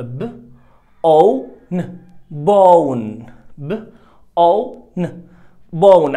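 بون split into ب أو ن; sounded out. Shown as a demonstration. Teacher segmenting bone. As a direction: sound out each sound then blend. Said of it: "عندي تلات أصوات".

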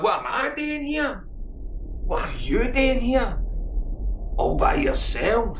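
high shouted retort, mocking; mocking mate. Mate mocking the wee guy for being alone, high shout. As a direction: throw the question back at him.

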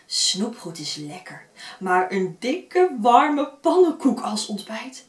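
reading a fairy tale aloud; story narration. A narrator's reading. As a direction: tell it like a bedtime story.

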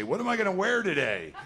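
high, loud musing over clothes; exaggerated wondering, pitched well up; mock outfit deliberation. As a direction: play someone fussing over what to wear. Mimicking someone choosing an outfit.

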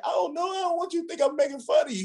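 put-on high-pitched pleading of a flustered child; imitated nervous protest. Crying boy explaining himself to his teacher, high nervous protest. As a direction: plead up high, retelling a boy's panic.

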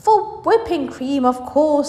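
high, emphatic, mock-obvious lecture; animated, exasperated explanation; indignant explaining. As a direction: insist on it as if everyone knows. Wonka explaining absurd logic indignantly.

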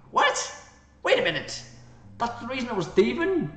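loud, high-pitched incredulous outburst; shocked disbelief. Real, not acted. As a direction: react as if blindsided by the news. Reacting to the game's plot reveal.

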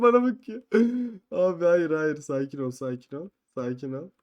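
ordinary talk, nothing marked

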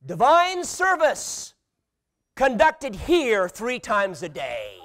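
slow, high, loud proclamation; mock-solemn announcement of "Divine service"; grand reading. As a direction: read the sign like a church notice. Reading kitchen inscription.

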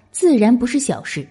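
ordinary delivery

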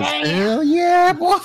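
high whooping yell; hillbilly yell. Pretend. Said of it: "And then the J-Dad just goes".

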